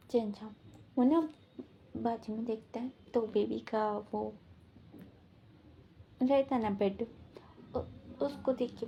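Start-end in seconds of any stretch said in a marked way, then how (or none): none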